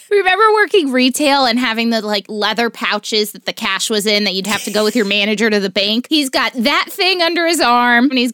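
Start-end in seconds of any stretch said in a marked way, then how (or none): none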